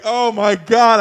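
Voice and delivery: high, loud mock dismay; acted-out horrified outburst; retold alarm. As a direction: exclaim it as if watching a disaster. Retelling his alarm at the scene.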